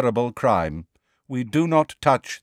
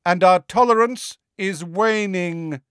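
calling after; 10 semitones higher, 4 dB louder than usual. A narrator's reading. Centaur Magorian threatens departing Hagrid, calling out. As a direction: call the warning across a distance. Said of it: "Megorian called after them".

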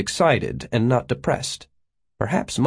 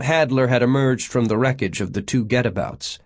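plain reading voice

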